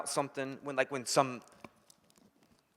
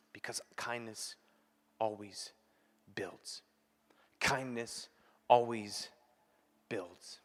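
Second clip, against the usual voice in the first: soft, slow spaced-out words; soft refrain; 4 semitones lower, 7 dB quieter, slower than usual. Acted. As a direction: let the refrain sink in softly. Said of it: "repeated refrain, soft".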